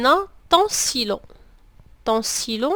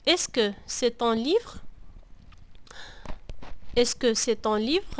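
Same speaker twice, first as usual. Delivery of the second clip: slow, careful Est-ce que c'est ton livre?; slow question demo; 4 dB quieter, slower than usual. Shown as a demonstration. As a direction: model the question slowly for learners. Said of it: "يسألك أحد". (سؤال بطيء وواضح للمتعلمين)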